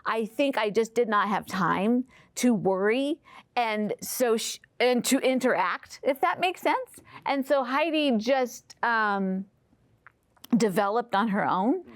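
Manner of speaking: normal talking voice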